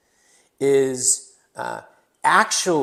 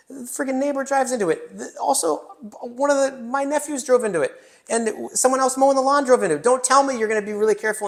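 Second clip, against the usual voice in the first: exasperated homeowner; 10 semitones higher, 3 dB louder than usual. Retold or imitated. Mock exasperated homeowner rant.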